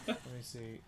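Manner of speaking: ordinary talk, nothing marked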